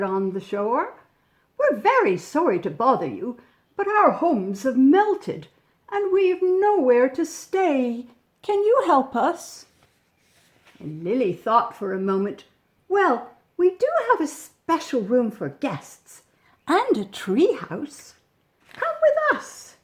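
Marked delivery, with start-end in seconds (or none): 1.6-9.7 a hint of a higher, pleading polar bear; lightly voiced bears asking for help; gently pleading creatures; polite bear voice. Polar bears speaking to Lily. 12.9-19.8 higher, lightly voiced little girl; welcoming child rising high at the end; Lily inviting the bears in. Lily answers the polar bears.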